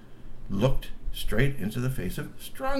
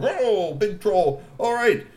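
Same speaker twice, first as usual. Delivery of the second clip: high, loud booming troll host; 9 semitones higher, 5 dB louder, much slower than usual. Acted. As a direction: announce it like a proud troll chief. Glub's troll voice.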